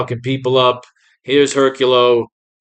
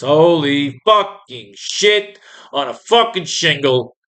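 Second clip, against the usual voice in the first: ordinary talk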